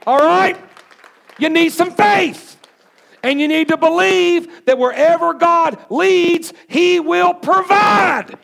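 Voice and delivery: high, loud, shouted preaching that keeps climbing; preaching at full force. Real, not acted. Pastor fires up a nervous church about the building vote, full-force shouting preaching. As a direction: preach it hard, building to a shout on every point. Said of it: "Maybe I need to calm down".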